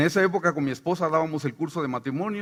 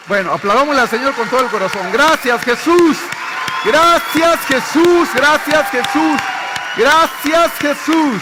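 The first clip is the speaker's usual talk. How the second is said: loud, high, fast call to applaud; praise shout; 8 semitones higher, 9 dB louder than usual. Real, not acted. Pastor leading the church in praise: loud, high shout. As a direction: lift the congregation with loud praise.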